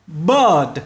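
bird with the vowel drawn out long. Shown as a demonstration. Teacher drawing out bird for students. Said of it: "kéo dài".

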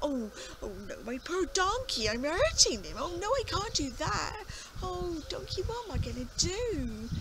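high, loud anguished fretting; distraught old farmer. Acted. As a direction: wail over the poor donkey. Farmer distraught over hurting donkey.

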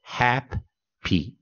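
"happy" slow, hap stressed, py weak; model word. Shown as a demonstration. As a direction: say "happy" with a light weak ending. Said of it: "他这样念".

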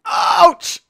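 sudden loud, high yelp; yelp of pain. Real, not acted. Player hit in the game, yelping. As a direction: cry out as if just hit.

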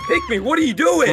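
very high furious yell; raging gamer. Real, not acted. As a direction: scream at the teammate. Yelling at teammate mid-game.

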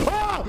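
sudden high startled yelp; startled scream. Real, not acted. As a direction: shriek as the head falls. Scream when attacked.

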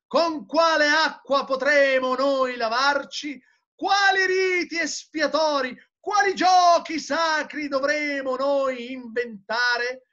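high, ringing theatrical oratory; impassioned declamation. A narrator's reading. Madman asking who will cleanse the blood, high impassioned declamation. Madman's tirade read aloud.

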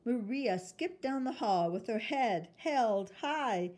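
plain reading voice

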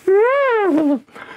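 loud high trumpeting call; elephant trumpet. Shown as a demonstration. Elephant trumpeting its good idea. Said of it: "sound of an elephant coming up".

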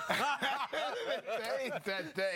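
slow high laughing repeat. Real, not acted. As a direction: laugh it out, drawn out.

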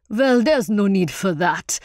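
rich-voiced welcoming performer; genial troupe leader. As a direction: jovially wave off the fuss.

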